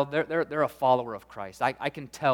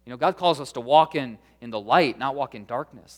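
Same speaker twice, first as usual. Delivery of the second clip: ordinary talk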